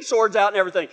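usual speaking voice

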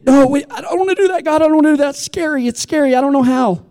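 high-pitched mock-fearful whine; whiny reluctance. Acting out believers balking at God's job, whiny reluctance. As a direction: plead your way out in a high voice.

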